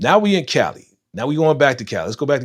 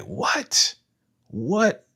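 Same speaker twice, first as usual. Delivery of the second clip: rising disbelief, higher on the second; stunned what; 9 semitones higher than usual. Retold or imitated. Watching the fight start, rising disbelief. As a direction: double take at the fight breaking out. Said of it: "And it's like".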